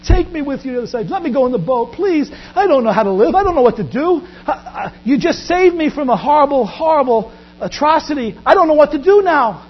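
high, urgent pleading that gathers force; begging. Acted. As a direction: beg as if terrified of being left behind. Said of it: "He begged him that".

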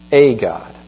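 "A" said as a full "ay", apart from "God"; stress on "A". Retold or imitated. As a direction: repeat it pointedly, leaning on "A". Repeats the disputed article.

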